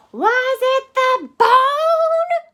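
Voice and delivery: high, loud, slow holler; dog hollering to the bird. Acted. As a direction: call it out across the yard. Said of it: "Rocket hollered".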